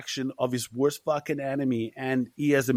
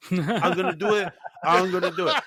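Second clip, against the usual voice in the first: laughing vow; 4 semitones higher, 4 dB louder than usual. Real, not acted. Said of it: "repeated vow".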